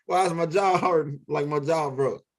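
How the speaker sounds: normal talking voice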